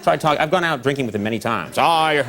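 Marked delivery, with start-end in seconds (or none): 1.8-2.2 N=Tom Brokaw/public; Brokaw impression. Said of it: "How he talks".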